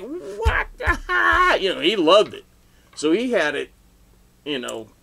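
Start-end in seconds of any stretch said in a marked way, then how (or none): 0.0-1.6 much higher, slow drawn-out what the hell; stunned door guy. Retold or imitated. Door guy staring at the photo, slow stunned disbelief. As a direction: stretch it out in disbelief.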